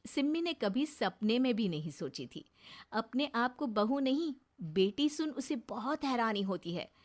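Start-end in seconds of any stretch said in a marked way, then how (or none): none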